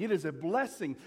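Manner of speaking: usual speaking voice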